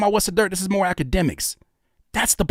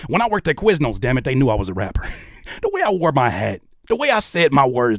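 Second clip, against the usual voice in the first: ordinary talk, nothing marked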